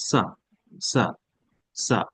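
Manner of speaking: British-style "সা" with no r at the end; non-rhotic British "সা"; British silent R. Shown as a demonstration. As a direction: stop "সা" on a long open vowel, British fashion. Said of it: "আর বলে না".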